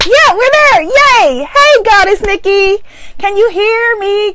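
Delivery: high, loud, delighted cheer; squealing, elated greeting; excited. Real, not acted. Relieved broadcast is live, greets viewer.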